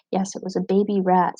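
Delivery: ordinary delivery, nothing marked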